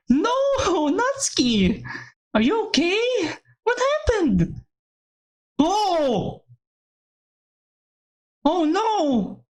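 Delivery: put-on high, frantic girl's voice, pitched well up; a panicked girl crying out for her friend; distressed young girl. Frantic girl calling out to her friends in the hospital hall.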